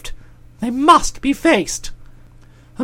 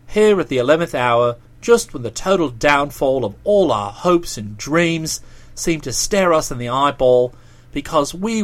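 much lower-pitched male character voice; low, protesting dialogue voice; Boko, earnestly pleading; 12 semitones lower, 4 dB quieter than usual. Acted. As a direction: reason with him in a deep voice.

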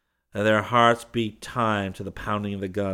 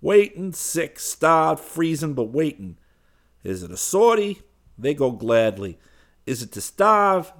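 higher, louder, feverish voice of a starving man; bitter starving Parisian; 8 semitones higher, 8 dB louder than usual. Acted. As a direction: throw out the questions bitterly, half-mocking. Man in crowd speaks bitterly.

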